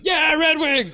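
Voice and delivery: high-pitched drunk whoop, imitated; wasted fan cheer. Drunk girl spots a matching team jacket, mock cheer. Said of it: "she was wasted".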